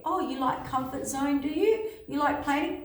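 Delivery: high, rising teasing questions; playfully needling, voice lifted; mock taunting. Universe taunting people who like comfort.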